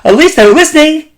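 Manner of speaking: tossed-off joking reply, voice up; parents' quip. Retold or imitated. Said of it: "They're like".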